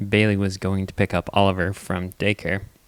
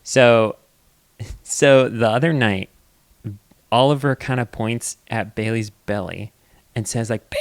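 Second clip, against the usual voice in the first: ordinary talk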